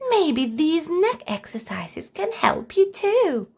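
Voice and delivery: offering help to grandpa; encouraging child. Acted.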